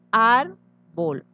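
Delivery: árbol partido en dos: ár... bol; separado en sílabas. Shown as a demonstration. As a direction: alargar la primera sílaba de árbol y pausar. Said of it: "Al dividir queda". (árbol lento, sílaba por sílaba)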